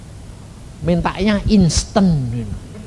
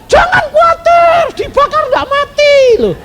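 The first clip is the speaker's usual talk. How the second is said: mock reassurance; 4 dB louder than usual. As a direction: exaggerate an absurd promise at the top of the voice. Acts out absurd mock reassurance.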